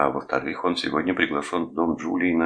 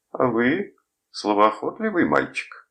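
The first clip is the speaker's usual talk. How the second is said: higher, drawn-out teasing remark; amused; 8 semitones higher, 4 dB louder than usual. A narrator's reading. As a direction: say it high and slow, as if amused. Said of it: "засмеялся Бойлон". (слегка насмешливо, со смешком в интонации)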